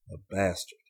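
ordinary delivery

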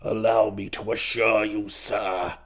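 put-on very low, odd old-duke voice; rummy voice. A narrator's reading. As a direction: assure them solemnly, right down low. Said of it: "he said in a rummy kind of voice".

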